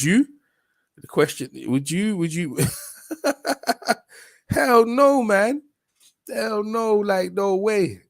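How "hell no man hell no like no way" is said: emphatic refusal. Real, not acted. Reacting to the question.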